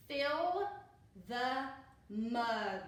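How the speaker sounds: slow, word by word read-aloud for beginners. Shown as a demonstration. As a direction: point and read one word at a time. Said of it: "Next sentence".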